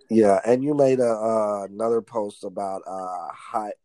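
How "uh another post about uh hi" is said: ordinary talk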